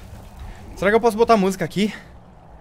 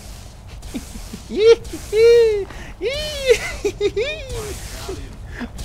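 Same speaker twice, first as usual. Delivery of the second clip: high taunting laugh; 14 semitones higher than usual. Acted. Taunting laugh after peekaboo.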